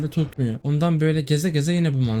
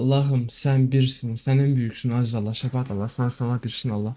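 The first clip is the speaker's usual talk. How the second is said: mock prayer; 3 semitones lower than usual. Mock prayer after level.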